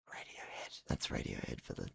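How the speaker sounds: whispered aside. Acted. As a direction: hush it like a secret.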